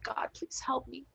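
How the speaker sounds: slow, breathless whisper of a plea; whispered prayer. Retold or imitated. Retelling a prayer said in Italy, in a slow whisper. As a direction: whisper it to God like a desperate prayer. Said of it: "I was like".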